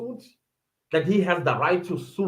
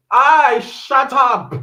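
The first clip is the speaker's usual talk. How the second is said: slow, loud, high snap; mock outraged shut up; 7 semitones higher, 7 dB louder, much slower than usual. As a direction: bark it out slowly. Reacting angrily to court commentary.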